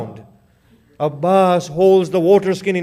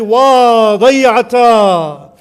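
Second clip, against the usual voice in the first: slow, loud drawn-out keening; wailing lament; 4 semitones higher, 7 dB louder, slower than usual. Acted. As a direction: wail each cry long and loud. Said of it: "She slapped her face and she said".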